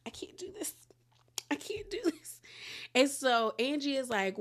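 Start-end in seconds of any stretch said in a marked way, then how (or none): 0.0-2.4 high, whispery mock-tearful voice; Brittany holding back tears. Woman crying at seeing Jared, tearful whisper. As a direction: imitate someone whimpering through held-back tears. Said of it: "trying not to cry, but she crying".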